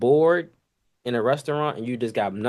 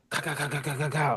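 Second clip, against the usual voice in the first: soft quick clicking ka ka ka; quick ka sounds; 3 semitones higher, 7 dB quieter than usual. Retold or imitated. Cook mimicking hanging up tickets, soft rapid clicks. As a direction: tick off each check with a soft click.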